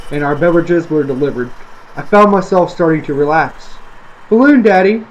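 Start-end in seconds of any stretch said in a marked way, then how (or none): 4.3-5.1 put-on high, loud little girl's call; excited child's shout. A narrator's reading. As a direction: kid tugging for attention. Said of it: "Janie shouted again".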